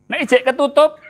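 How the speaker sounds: high and loud exclamation; loud call-out. Real, not acted. Preacher calling out to the congregation, loud. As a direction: call it out over the crowd.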